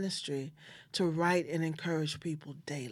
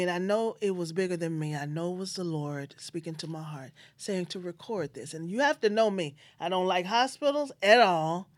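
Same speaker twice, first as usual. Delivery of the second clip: usual speaking voice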